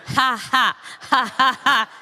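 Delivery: loud, high staged laugh on the beat; rhythmic laugh. Shown as a demonstration. Speaker leading students in rhythmic staged laughter. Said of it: "Now laugh at the same rhythm".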